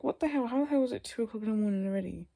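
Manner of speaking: high, loud, baffled outburst; retold disbelief. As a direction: blurt it out in animated disbelief. Retold shock at lost time.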